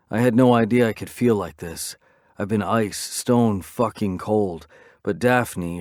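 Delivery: plain reading voice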